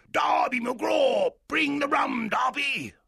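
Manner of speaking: a ghost calling from afar; unearthly voice. Acted. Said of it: "it shouted from far away".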